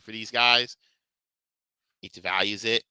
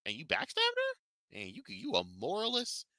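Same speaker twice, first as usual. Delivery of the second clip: incredulous; 5 semitones higher, 7 dB quieter than usual. Real, not acted. Outraged at streamer's betrayal.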